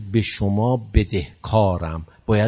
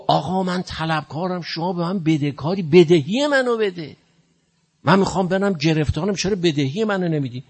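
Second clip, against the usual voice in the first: mock shout; 8 semitones higher than usual. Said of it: "اونجا داد بزن".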